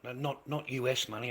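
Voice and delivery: usual speaking voice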